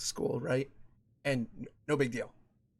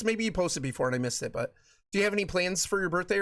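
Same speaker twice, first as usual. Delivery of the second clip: usual speaking voice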